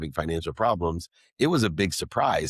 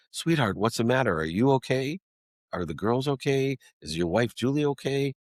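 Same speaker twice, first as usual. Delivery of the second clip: lightly voiced worried mother, higher; a hint of a caring mom asking; gentle mom voice; 7 semitones higher than usual. Son retelling how his mom asked if he was okay, lightly voiced mother.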